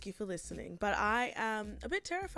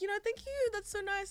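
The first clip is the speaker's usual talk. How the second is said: high, much higher, sugary polite thanks; mock-gushing high thanks to a flirting delivery guy; overly sweet thank-you; 11 semitones higher than usual. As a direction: gush politely to fend him off.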